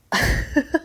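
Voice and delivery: breathy burst of real laughter; a short real laugh. Real, not acted. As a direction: laugh out at the memory. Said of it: "I'm laughing now".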